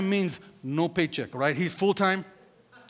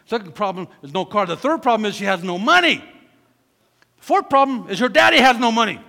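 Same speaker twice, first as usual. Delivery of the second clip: rising louder with each problem, slowing at the end; building to a shout; 4 semitones higher, 11 dB louder than usual. Acted. Preacher piling up the daughter's problems, building to a shout. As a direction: pile it up louder and louder till it peaks.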